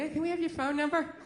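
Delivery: lightly voiced chirpy cashier asking; a hint of a polite store clerk.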